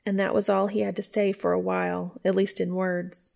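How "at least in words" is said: plain reading voice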